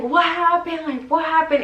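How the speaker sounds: high-pitched imitation of alarmed questions; excited questioning. Retold crowd asking what happened.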